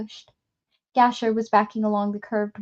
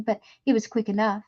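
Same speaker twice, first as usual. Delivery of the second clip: usual speaking voice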